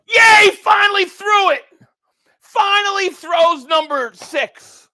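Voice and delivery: loud, high cheer of relief; real gleeful celebration; jubilant shout. Real, not acted. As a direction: cheer it out, stretching the words. Celebrates the long-awaited interception.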